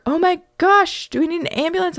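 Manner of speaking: high-pitched, mildly hammed-up alarm; mock-panicked bystander; alarmed neighbors. Panicked neighbors run out, voiced.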